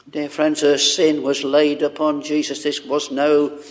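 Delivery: normal talking voice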